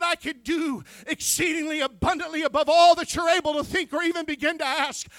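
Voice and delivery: loud, high preaching shout that keeps building; God's voice, preached in a shout. Acted. Preacher voicing God to the congregation, rising shout. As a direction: thunder it out as God.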